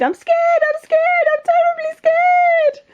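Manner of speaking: very high repeated squeal, stretching at the end; terrified cook. Acted. Cook declaring his fear to the king, squealing in terror. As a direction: build a hammed-up wail of fear.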